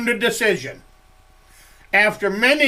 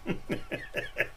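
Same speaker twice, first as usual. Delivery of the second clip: soft breathy nervous giggle; nervous laugh; 16 dB quieter than usual. Real, not acted. Nervous about tagging Grim, a soft breathy laugh. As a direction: laugh under your breath, a little scared. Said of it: "You don't know why I'm laughing".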